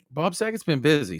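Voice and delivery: ordinary talk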